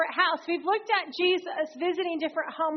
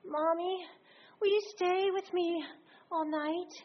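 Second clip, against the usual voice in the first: put-on trembling child; 4 semitones higher, 3 dB quieter than usual. A narrator's reading. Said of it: "he asked in a trembling voice".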